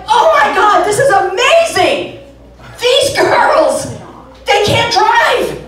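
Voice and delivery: high, loud, drawn-out exclaiming; mock amazement. Comic watching women in burqas drive on TV, mock amazement.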